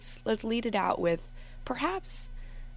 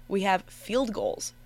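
ordinary talk

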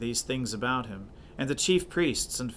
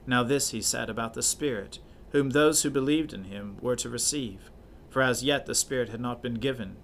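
usual speaking voice

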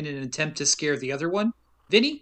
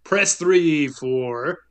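slow, loud announcer voice; phone menu announcer, drawn out; movie phone guy; 4 dB louder, much slower than usual. Retold or imitated. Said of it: "like, the movie phone guy".

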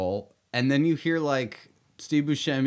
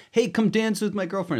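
higher-pitched, pushy mock friendliness; mock eager invitation; 9 semitones higher than usual. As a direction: play an overly keen host egging someone on. Acting out an overeager invitation to dance.

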